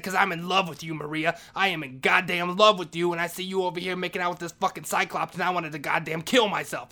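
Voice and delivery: loud, impassioned declaration of love; desperate confession. Retold or imitated. Janitor confessing love to Maria, desperate loud outburst.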